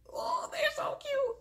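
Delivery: very high, squeaky aww; gushing. Real, not acted. Host gushing over the cute couple, squeaky coo.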